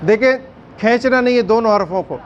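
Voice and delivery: normal talking voice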